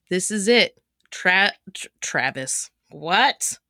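ordinary talk, nothing marked

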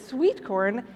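ordinary talk, nothing marked

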